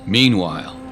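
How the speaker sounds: usual speaking voice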